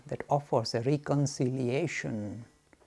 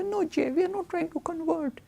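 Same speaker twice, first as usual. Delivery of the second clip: cozy minister voice; 14 semitones higher than usual. Retold or imitated. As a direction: play the gentle, friendly cleric protesting. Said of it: "nice, cozy fellow".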